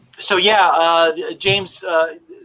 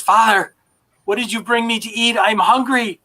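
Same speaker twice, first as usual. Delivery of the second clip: feral son begging; 6 semitones higher than usual. Acted.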